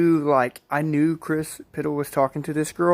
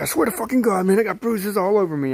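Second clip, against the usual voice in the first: mildly heated, higher-pitched pleading; higher, emphatic protest of a retold friend; insistent swearing; 6 semitones higher, faster than usual. Friend swearing to a doubter he has bruises, insistent.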